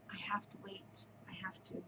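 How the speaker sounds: whispered to herself; hushed self-reminder. Real, not acted. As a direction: whisper it like a private vow. Whispering self-restraint about casting on.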